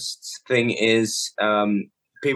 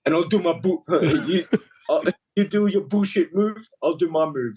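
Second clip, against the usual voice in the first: lightly imitated Greek accent, pitched up; 4 semitones higher than usual. Wrestler planning a match with his opponent, in an imitated Greek accent. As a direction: retell it in a mock Greek accent. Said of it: "so he had that accent".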